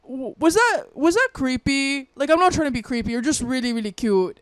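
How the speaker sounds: loud, high-pitched imitation with a rough Canadian lilt; attempted Canadian accent. Creepy customer insisting he isn't creepy, attempted Canadian accent. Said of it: "I'm trying to do a Canadian accent".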